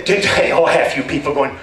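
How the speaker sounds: normal talking voice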